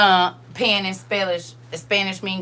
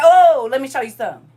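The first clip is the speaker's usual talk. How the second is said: loud, higher-pitched warning; retold telling-off; 5 semitones higher, 10 dB louder than usual. Woman retelling her warning to a rude customer, loud challenge. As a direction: come in loud to put him in his place.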